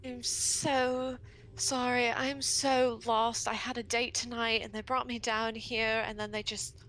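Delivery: stretched-out opening apology, then pitch climbing into pleas; lost date act. Acted. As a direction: play helplessly lost, begging for a way out. Player acts lost damsel act.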